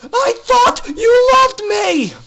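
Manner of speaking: high, slow anguished wail; betrayed filly wails at her big sister; wailing shout. Acted. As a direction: cry it out to the one who betrayed her. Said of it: "she wailed".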